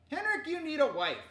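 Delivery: high, loud sisterly reproach; teasing scolding. Acted. Sister scolding her brother.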